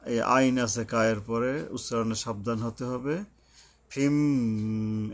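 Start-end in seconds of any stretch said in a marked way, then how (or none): 3.9-5.1 "ফিম" with a long hummed m; very slow "ফিম", nasal close; ghunnah. Shown as a demonstration. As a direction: hold the m of "ফিম" in the nose. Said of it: "মিমের উপরে গুণনা করতে হবে".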